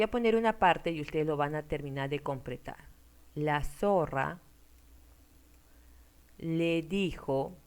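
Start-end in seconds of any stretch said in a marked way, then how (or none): 3.3-7.6 words drawn out slowly for students to copy; slow dictation. Shown as a demonstration. (dictado lento)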